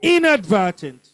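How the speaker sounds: Inadvertent punched on "In"; stress on first syllable. Shown as a demonstration. Teacher showing the class stress patterns, Inadvertent stressed on first syllable. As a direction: hit the opening syllable of Inadvertent hard. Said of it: "First syllable".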